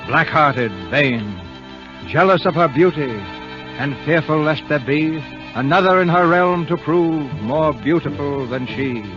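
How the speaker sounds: ordinary talk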